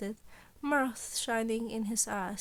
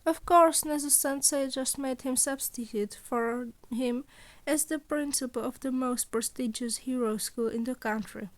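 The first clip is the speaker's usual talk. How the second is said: ordinary delivery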